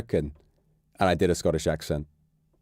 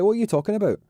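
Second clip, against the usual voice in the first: mock innocent denial; 12 semitones higher, much faster than usual. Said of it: "I'd be like".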